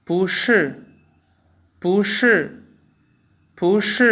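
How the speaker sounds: पुश as Mandarin bú shì, bu rising and shi falling. Shown as a demonstration. Teacher drills Hindi learners on the bu tone change, slow clear Mandarin. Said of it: "मसलन".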